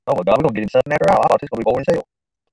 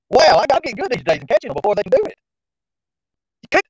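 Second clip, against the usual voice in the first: ordinary delivery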